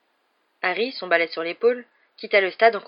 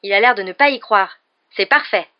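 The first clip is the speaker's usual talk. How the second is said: loud, gloating pleasure; delighted; 2 semitones higher, 9 dB louder than usual. A narrator's reading. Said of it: "d'un ton ravi".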